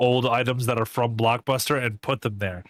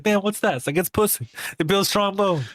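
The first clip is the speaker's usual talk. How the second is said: high, hammed-up goofy friend voice; mock goofy voice; 7 semitones higher than usual. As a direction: play it up like a dopey buddy. Acting out a friend's reaction.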